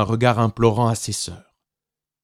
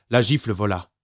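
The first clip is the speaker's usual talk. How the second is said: ordinary delivery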